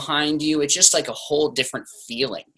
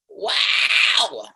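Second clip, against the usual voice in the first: relaxed guttural rock scream. Shown as a demonstration. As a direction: let out a gritty fry scream. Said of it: "Kinda like this guttural".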